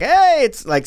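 high-pitched drawn-out Hey; acted greeting. Voicing friend in a what-if, high-pitched greeting. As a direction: sing out Hey high, then fall. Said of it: "and you're like".

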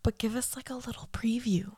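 unvoiced, whispered aside. Real, not acted. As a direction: whisper it close to the mic. Whispered request.

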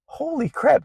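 "Holy crap" thrown far up high in disbelief; amazed surprise. Retold or imitated. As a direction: gasp it out, stunned that it worked. Amazed the spirit mango worked.

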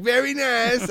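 put-on a light Borat-style accent. Host jokes about celebrating, light Borat accent. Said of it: "I just do more Borat".

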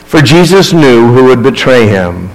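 ordinary delivery

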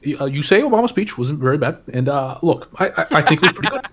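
Obama impression. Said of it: "Yeah, I do impressions".

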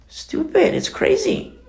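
high-pitched exasperated outburst; retold exasperation, voice jumping up. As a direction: toss it out in a high, incredulous voice. Scorns what critics watch on TV.

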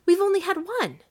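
high, loud, frantic insistence; urgent reply. A narrator's reading. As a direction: press the point, high and loud. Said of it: "she replied rapidly".

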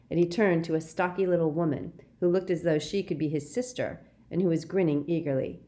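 usual speaking voice

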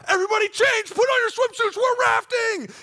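high, loud rallying yell; shouted announcement. Retold or imitated. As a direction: imitate a boss calling everyone to action. Boss calls out to all the kids.

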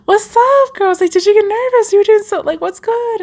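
a high, eager what's up to a nervous friend; squealing hello to a friend; excited retold greeting. Calling friend after her live.

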